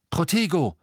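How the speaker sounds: sudden high, loud cast; urgent incantation shout; shouted spell. A narrator's reading. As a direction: cry out the spell on instinct. Harry casts shield charm instinctively.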